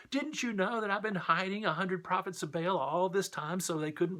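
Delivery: high, pleading, frantic voice; panic mode. Acted. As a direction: whine it as if doomed. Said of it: "Obadiah went into panic mode".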